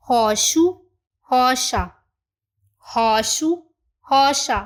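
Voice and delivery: roxo with a breathy h start and sh middle; model pronunciation. Shown as a demonstration. Teacher models roxo and roxa for learners. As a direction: say roxo as hosho, clearly. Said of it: "पहले हम इनकी pronunciation को देख लेते हैं".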